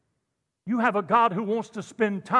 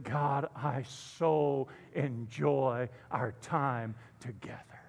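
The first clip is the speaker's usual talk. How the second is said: slow, much softer, fading to a whisper; intimate prayer; 7 semitones lower, 9 dB quieter, much slower than usual. Acted. Daniel speaking closely to God, soft and slow. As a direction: pray it tenderly, almost breathless.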